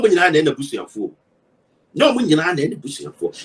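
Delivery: "busu, ya, fo" chopped into separate syllables with pauses. Acted. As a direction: break the word apart, syllable by syllable. Spelling out a word syllable by syllable.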